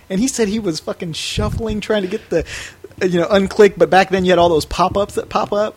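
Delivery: normal talking voice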